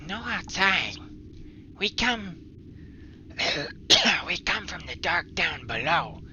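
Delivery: high, loud, rough little goblin. Acted. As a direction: squeak it out like a cave goblin. Goblin speaks, DM voice.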